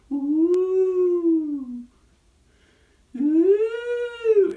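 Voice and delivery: high, loud spooky wail; ghost ooh. Acted. Spooky ghost puppet show.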